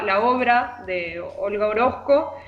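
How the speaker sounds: normal talking voice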